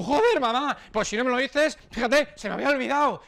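réplica irritada, más aguda y más fuerte; hija exasperada. Retold or imitated. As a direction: suelta la queja de la hija harta de su madre. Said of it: "Y tú". (hija respondiendo con fastidio en voz alta)

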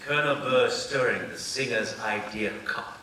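slow, over-precise standard American accent; mock drama-school standard American diction; standard American speech. Shown as a demonstration. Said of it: "So you talk like this".

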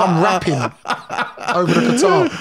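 drawn-out words through laughter; tickled, laughing delivery; laughing while telling it. Real, not acted. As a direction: crack up while telling the story. Amused recalling an absurd scene.

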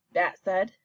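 usual speaking voice

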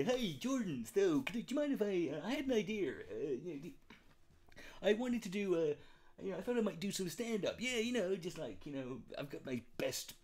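hammed-up nervous pitch to Jordan; mock timid Rubin. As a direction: fumble the ask like a nervous sidekick. Voicing Rubin asking Peterson.

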